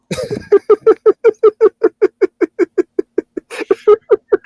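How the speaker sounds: long, high-pitched burst of laughter; real belly laugh at the memory; loud laugh. Real, not acted. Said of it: "I laugh about it now".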